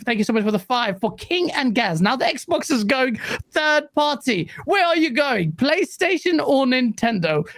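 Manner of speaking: ordinary talk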